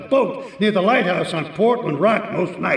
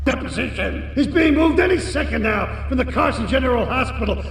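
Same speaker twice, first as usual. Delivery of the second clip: furious shout; 6 semitones higher than usual. Acted. Crime boss screaming at his failed hitman, furious. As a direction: tear into the useless hired gun.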